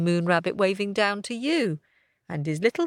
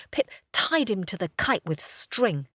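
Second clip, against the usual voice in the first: plain reading voice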